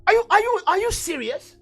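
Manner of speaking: high, loud disbelief; incredulous challenge. Acted. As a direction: burst out in disbelief, pitch jumping up. Preacher mocking those unaware of origins.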